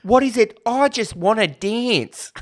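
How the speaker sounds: very high, loud Aussie film whine; mimicked Aussie accent. Host mimics the film's dancers wanting to dance, high mock Aussie accent. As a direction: draw it out in hammed-up Australian. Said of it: "They all sound like that".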